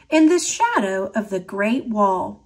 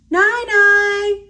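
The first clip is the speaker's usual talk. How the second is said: higher, louder call across the house; 10 semitones higher, 4 dB louder than usual. A narrator's reading. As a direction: call out to grandma. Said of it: "she called out".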